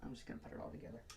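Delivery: fast whispered self-talk; quick hushed plan to yourself. Real, not acted. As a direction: rush it out in a whisper. Aside while working on hair.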